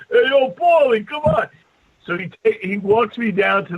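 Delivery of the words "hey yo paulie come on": calling out. Retold or imitated. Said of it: "they see me do it".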